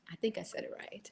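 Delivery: breathy whisper to herself, voice almost gone; a doubtful little whisper under her breath; whispered aside. Real, not acted. Host doubting her pronunciation of a viewer's name, whispering. Unsure aside while reading names.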